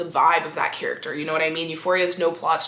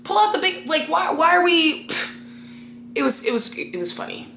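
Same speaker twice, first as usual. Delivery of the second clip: ordinary delivery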